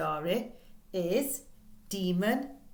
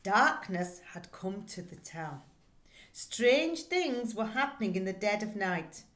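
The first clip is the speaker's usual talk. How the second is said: spooky story reading; 4 dB louder than usual. Acted. Teacher reads the spooky blurb to her class, dramatically. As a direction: read it like a spooky tale to kids.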